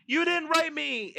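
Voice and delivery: high, drawn-out indignant whine; hammed-up, high-pitched accusation; mock mad. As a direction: imitate an angry movie heroine, stretched out. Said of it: "she's all mad".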